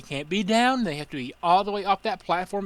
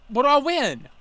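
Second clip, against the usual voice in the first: higher, louder mock question; mock entitled customer; 7 semitones higher, 4 dB louder than usual. Customer asking the worker after missing, mock eager. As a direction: act like a customer sure he won.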